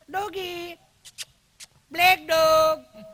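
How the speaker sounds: high, loud, slow squeal; excited call to dog. Retold or imitated. Ladies calling at the dog.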